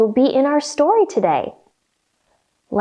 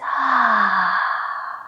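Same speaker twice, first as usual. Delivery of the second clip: sigh; 4 semitones lower than usual. Shown as a demonstration. Breathing out in yoga.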